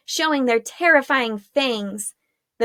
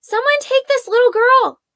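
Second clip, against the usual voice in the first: high, loud call after them; 13 semitones higher, 7 dB louder than usual. Acted. Arella calling to the fleeing women, loud high call. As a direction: shout across to get their attention.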